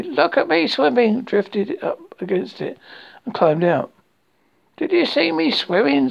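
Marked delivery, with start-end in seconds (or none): 0.0-1.2 high, proud little squeal; bubbling proudly. A narrator's reading. Roo drifting up to the pole, high proud boast. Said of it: "still bubbling proudly". 4.8-6.1 high little-animal squawk; excited squawk. A narrator's reading. Roo climbing out wanting praise, high excited squawk. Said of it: "squawked Roo excitedly".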